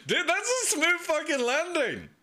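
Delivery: high, thrilled exclamation; real amazement. Real, not acted. Viewer praising a smooth landing, high amazement.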